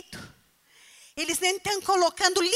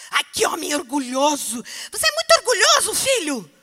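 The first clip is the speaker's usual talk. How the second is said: mock scolding mother; 9 dB louder than usual. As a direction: nag the boy as a fed-up mother. Mother scolding proud son. (mãe ralhando alto e rápido com o filho)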